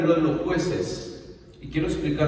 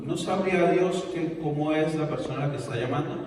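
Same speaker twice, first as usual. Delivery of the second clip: ordinary delivery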